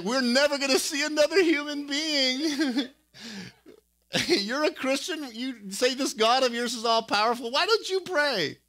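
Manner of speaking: high, laughing, whining mock complaint; whiny hangry steersman. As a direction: ham up a starving sailor's whine through laughter. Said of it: "He's like".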